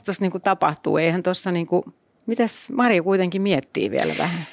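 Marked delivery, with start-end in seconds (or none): none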